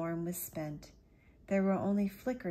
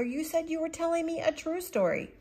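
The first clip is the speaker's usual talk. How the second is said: indignant protest; 12 semitones higher, 7 dB louder than usual. Acted. Boy protests the tall tale.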